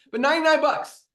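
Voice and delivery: high, loud disbelief at the price; incredulous outrage. Real, not acted. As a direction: scoff at how absurd the price is. Mocks a competitor's price.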